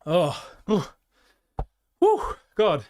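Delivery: winded, puffing between words; out of breath. Real, not acted. Streamer back from running up three flights of stairs, out of breath. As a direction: catch your breath after running upstairs. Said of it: "I am out of breath".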